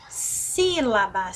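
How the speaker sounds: SÍ of "Sílaba" drawn out slowly; stretched first syllable. Shown as a demonstration. Teacher isolates the word sílaba for the class, first syllable stretched. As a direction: linger on the SÍ in "Sílaba". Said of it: "nós ficamos mais tempo falando sílaba".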